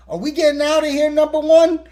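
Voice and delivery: high, loud mock captain's call; Picard. Captain asks his first officer about leaving, hammed-up brisk call. Retold captain calls out to Riker.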